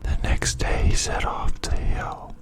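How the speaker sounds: usual speaking voice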